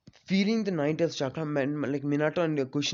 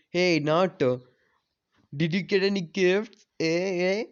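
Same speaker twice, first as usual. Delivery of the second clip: higher, mock-teasing prod with a smirk; smirking taunt; 4 semitones higher, 4 dB louder than usual. Arrogant siblings taunting their brother about gifts, smirking. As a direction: needle him with a smirk.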